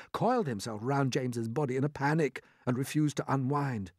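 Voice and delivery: plain reading voice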